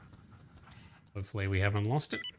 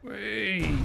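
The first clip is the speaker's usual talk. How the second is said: gleeful whee; 8 semitones higher than usual. Real, not acted. Playful cheer while moving.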